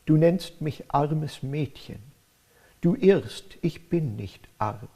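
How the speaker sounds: slow German verse with stressed beats; recited verse. Shown as a demonstration. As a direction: recite the couplet, leaning on the stresses. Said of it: "I'll recite the first couplet".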